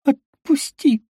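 strangled high gasp; gasping for air. A narrator's reading. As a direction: force it out while choking. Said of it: "задыхаясь, выдавила из себя".

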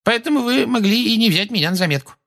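very high, thin, level woman's voice; explaining witness. A narrator's reading. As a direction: explain yourself high-pitched on a held note. Mrs Figg's acted explanation continues.

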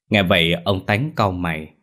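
ordinary delivery

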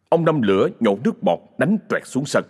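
ordinary delivery, nothing marked

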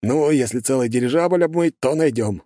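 grinning, giving in; smiling guard voice. A narrator's reading. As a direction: relent with a grin. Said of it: "улыбнулся Остапов".

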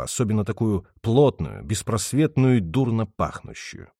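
ordinary delivery, nothing marked

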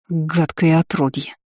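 slow, disgusted spitting insult; spat with contempt. A narrator's reading. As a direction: spit the words out. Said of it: "выплюнула я".

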